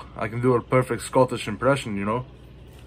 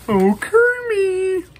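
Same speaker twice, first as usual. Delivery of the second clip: very high, slow, swooning falsetto; a hammed-up diva pig sighing for her frog; Miss Piggy impression; 6 dB louder, much slower than usual. Showing the Miss Piggy figure, in a hammed-up swooning falsetto. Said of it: "We got Missus Piggy".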